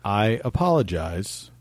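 very slow, low, measured; solemn apology. Acted. Guest gives in to the crying engineer, slow solemn apology. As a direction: spell out the apology with heavy deliberateness. Formal grudging apology after pleading.

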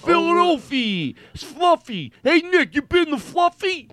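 high, shouted cartoon Philly accent; mock Philadelphia accent. Comedian guesses how they say Philadelphia, loud mock accent. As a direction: holler it like a caricatured Philadelphia local. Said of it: "That's how I think they say Philadelphia".

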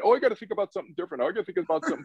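rushed, high mock self-talk; anxious inner voice. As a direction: rattle it off like a frantic mind. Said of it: "try to get yourself out of your head".

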